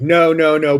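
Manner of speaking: high, loud pleading no's; retold begging. Begging the mugger to stop, high and loud. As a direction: beg him to stop, rising and loud. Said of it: "begging him".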